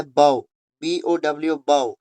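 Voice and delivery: बाउ said like "how", rhyming with cow; बाउ with the open "ow" sound of bending; pronounced bow. Shown as a demonstration. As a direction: say बाउ as the bend-at-the-waist bow. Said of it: "उस time इसका मतलब निकलता है".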